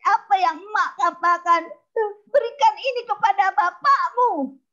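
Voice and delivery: high, loud, upset scolding mother. Acted. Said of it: "perempuan yang menangis".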